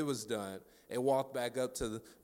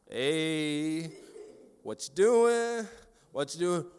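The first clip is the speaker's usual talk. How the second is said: drawn-out, rising call to a dog; acted uneasy "easy now" tone; wary calling; 4 semitones higher, 3 dB louder, much slower than usual. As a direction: slowly check a dog with a lifted voice. Acts out warily addressing loose dog.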